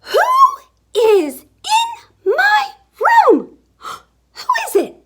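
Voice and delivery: very high, loud alarmed shriek; gasp, then demand it word by word; high-pitched shocked outcry; startled zookeeper's wife. A narrator's reading. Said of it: "Missus Zookeeper says".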